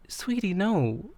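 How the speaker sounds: slow, high imitation of a soothing woman; retold gentle correction.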